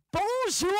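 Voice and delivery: high mock-French bonjour, drawn out; mocking echo. Classmates mocking correct French, jeering bonjour. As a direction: parrot bonjour in exaggerated ridicule. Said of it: "And people like".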